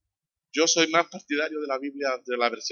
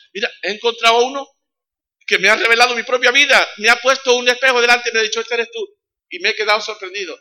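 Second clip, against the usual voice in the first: much higher and louder, thrilled; excited testimony; 10 semitones higher, 9 dB louder than usual. Acted. Samaritan woman to her friends, excited testimony. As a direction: tell it like you just found him.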